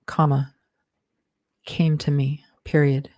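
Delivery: steady dictation with spoken punctuation; dictating. Acted. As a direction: read it out like dictating a draft. Author dictates novel with punctuation.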